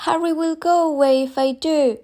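put-on high-pitched child's protest; worried little girl. A narrator's reading. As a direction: plead as a small girl. Child Chris protesting to mother.

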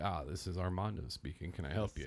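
soft, low phone sales voice; smooth sales voice. Retold or imitated. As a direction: put on the polished sales greeting. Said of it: "It's like a voice actor".

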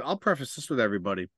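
normal talking voice

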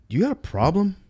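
hammed-up barroom challenge, pitched high; mock tough challenge. Retelling how he squared up to the rude guy, mock tough challenge. Narrator confronting guy at bar.

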